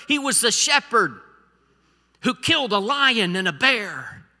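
loud, emphatic pulpit delivery; impassioned preaching. Acted. Preacher marveling at David the giant killer, impassioned. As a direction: punch out each phrase to the congregation.

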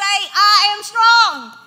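loud, high, drawn-out declaration; bold proclamation. Acted. Preacher proclaiming a verse to the congregation, bold and loud. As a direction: proclaim it at full force, each word stretched.

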